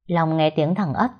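ordinary delivery, nothing marked

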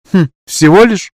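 high, loud mocking scoff. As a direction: snort it out dismissively. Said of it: "снова хмыкнула Янка".

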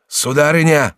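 alarmed cry. A narrator's reading. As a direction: call out urgently to the mistress. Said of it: "вскричал Реми".

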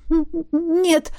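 stammering through chattering teeth. A narrator's reading. Wet girl answers the dragon, shivering stammer. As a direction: stutter as if shivering with cold. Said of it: "стуча зубами, ответила".

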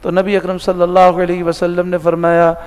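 normal talking voice

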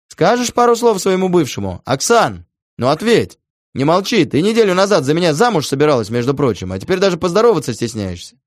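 loud, high jeering shout; shouting taunt. A narrator's reading. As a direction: yell the mockery into the speaker. Taunting ex over speakerphone.

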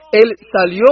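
ordinary delivery

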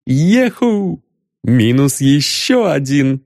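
high gleeful whoop; joyful inner cheer. Acted. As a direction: exult silently but brightly. (радостный внутренний возглас)

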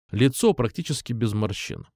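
plain reading voice

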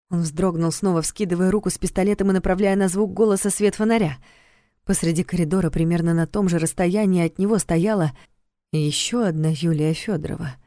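usual speaking voice

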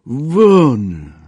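high, loud, drawn-out command; terrible-voiced roar. A narrator's reading. Major with revolver drawn at the soldier, terrible roar. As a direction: roar it out to scare him away. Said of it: "zawołał strasznym głosem".

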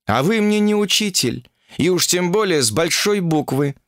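high-pitched, defiant retort; defiant interruption. Acted. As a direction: cut in on the guru, pitched high. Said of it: "вновь перебила его Ольга". (дерзко перебивая)

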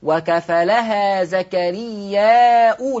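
وكفلها زكريا أو slowly, long madd and hamza-u; slow, chanted Quranic reading; Warsh recitation. Shown as a demonstration. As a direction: recite the whole phrase in tajweed style. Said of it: "هتضم الهمزة تصير أو".

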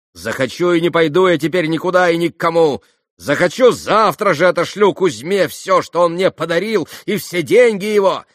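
high, loud, frenzied defiance; wild outburst of threats; hysterical shouting. A narrator's reading. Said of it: "Последние слова она истерически прокричала".

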